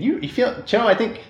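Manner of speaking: high-pitched, laughing through it. Acted. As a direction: giggle the question up high. GM voices JT on comm.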